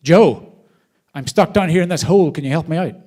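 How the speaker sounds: man in a hole shouting up to his friend Joe. Retold or imitated. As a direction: yell up to Joe to get him out. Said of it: "and he shouts up".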